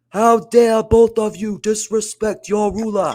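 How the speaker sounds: high, slow, hammed-up African accent as a haughty ruler; worst accent ever. Oba Femi scolding rivals in a terrible hammed-up African accent. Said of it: "I'm gonna do the worst accent you've ever heard".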